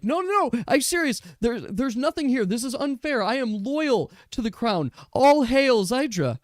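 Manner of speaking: high, desperate pleading voice; panicked high-pitched elf begging; frantic elf. Acted. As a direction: squeal your loyalty to save your neck. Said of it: "the other elf is like".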